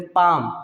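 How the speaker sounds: पाम in one syllable, no L; silent L. Shown as a demonstration. Teacher on an important word, पाम with silent L. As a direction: say पाम leaving L out. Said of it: "इसका सही sound होगा".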